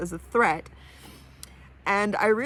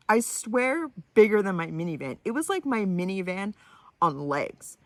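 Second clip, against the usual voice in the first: ordinary delivery, nothing marked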